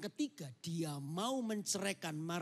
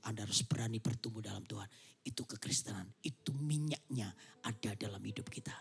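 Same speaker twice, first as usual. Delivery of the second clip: hushed, low; whispered closing point; 11 semitones lower, 6 dB quieter than usual. Real, not acted. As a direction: drop to a whisper.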